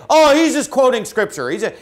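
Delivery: high mock voice of the doubters, starting loud; mocking the critics. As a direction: parrot the opponents with scorn. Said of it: "here's what they say".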